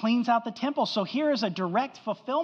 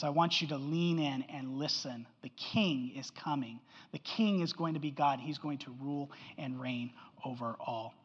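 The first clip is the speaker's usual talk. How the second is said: much softer, lower, drawing listeners in; hushed preaching; 5 semitones lower, 7 dB quieter than usual. Acted. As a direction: speak softly so they lean in. Intimate close of the point.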